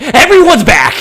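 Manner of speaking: very loud, high, excited yell; hyped fan shouting at full voice; triumphant shout. Real, not acted. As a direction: burst out with the big announcement. Triumphant climax of returning character list.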